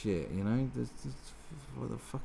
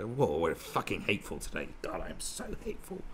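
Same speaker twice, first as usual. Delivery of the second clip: gloating office hater; 2 semitones lower, 5 dB quieter than usual. Retold or imitated. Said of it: "where they're".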